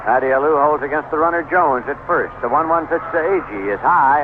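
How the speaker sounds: ordinary delivery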